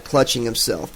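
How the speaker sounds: ordinary delivery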